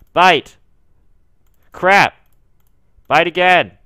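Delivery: tense gamer shouting; shouted commands. Real, not acted. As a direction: yell the attack at the screen. Commanding Pokemon attacks.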